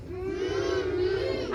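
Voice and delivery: soft two-beat hum; quiet closed-mouth syllable hum; hummed syllables. Shown as a demonstration. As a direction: hum the word without opening the lips. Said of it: "Hum it".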